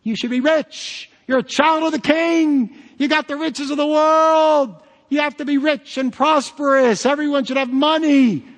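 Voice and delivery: high, loud hammed-up prosperity preacher; mock prosperity preacher. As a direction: parody a preacher promising riches, shouting it out.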